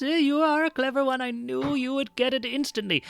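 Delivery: put-on high, approving old librarian; old Kender librarian. Librarian praises a clever visitor, high pleased old voice. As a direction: draw out the first words, high and pleased.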